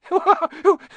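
squeaky delighted giggle; giddy high laugh. Acted. Diggle delighted.

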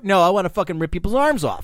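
ordinary delivery

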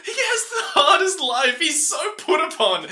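high, laughing disbelief; reading his notes through laughter; laughing exasperation. Retold or imitated. Host reading out his own notes on the put-upon dad, laughing. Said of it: "This is the point where I've written down".

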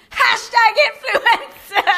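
loud, high, drawn-out heckle; retold scream. Heckling [name] when everyone goes silent, screamed. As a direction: holler it slowly across a silent crowd. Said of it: "and I scream".

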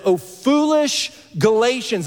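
high, drawn-out exasperated cry; exasperated rebuke. Retold or imitated. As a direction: scold them, stretching out the words. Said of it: "His exasperation and his language".

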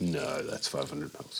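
low, soft, slow retold correction; acted letdown. Realising up close the bottle is smaller, acted as let down. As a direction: sink down quietly and slowly.

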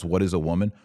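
ordinary talk, nothing marked

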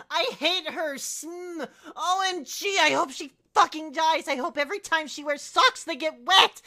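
put-on high, loud teenage tirade read out; raging rant. Reader performing a student's rant about her teacher, raging rant. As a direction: pile up the curses in hammed-up fury.

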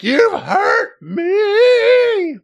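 very high, drawn-out wail; mock pained wail. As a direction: stretch it into a fake cry of pain. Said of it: "immediately is like".

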